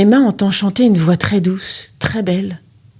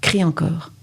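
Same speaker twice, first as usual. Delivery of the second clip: plain reading voice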